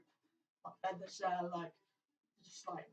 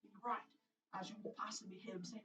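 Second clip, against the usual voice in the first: ordinary talk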